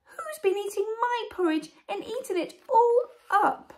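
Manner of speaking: very high little bear-cub voice; tiny Baby Bear. Acted. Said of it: "said in a tiny little voice".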